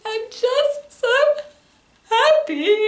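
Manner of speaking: imitating herself blubbering with joy, half laughing; crying happy tears. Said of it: "And I'm like".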